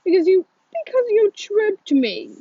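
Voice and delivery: high, loud accusing retort; heated accusation. A narrator's reading. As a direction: throw the blame straight back. Brady retorts in heated quarrel.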